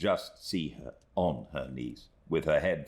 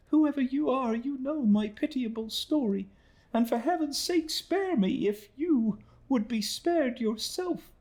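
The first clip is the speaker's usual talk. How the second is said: put-on much higher, pleading young woman's voice; pleading exclamation; 3 dB louder than usual. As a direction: beg for mercy in a high, fragile young woman's voice. Emily pleads with Rosa.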